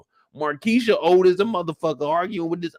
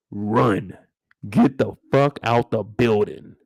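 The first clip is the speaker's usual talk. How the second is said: usual speaking voice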